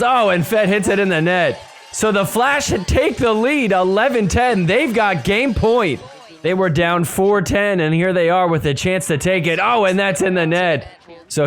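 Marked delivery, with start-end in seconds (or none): 0.0-6.0 excited call. Real, not acted. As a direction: pitch climbs high as the lead flips. Lead changes late in game.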